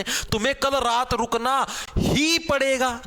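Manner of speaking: ही punched, slow sentence; stress on ही. Shown as a demonstration. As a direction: hit the word ही, slowly. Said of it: "जोर डाला है". (ही पर ज़ोर, धीमे)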